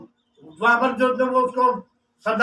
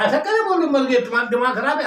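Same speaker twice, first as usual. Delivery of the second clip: indignant protest; 2 semitones higher, faster than usual. Acted. Wife refusing to say husband died, indignant protest. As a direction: scold with rising disbelief.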